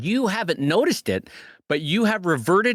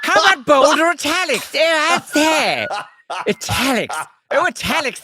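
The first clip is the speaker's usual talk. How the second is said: hammed-up, louder British accent; mock British RP; 8 semitones higher, 4 dB louder than usual. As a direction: relish the words in put-on British. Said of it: "in British R P".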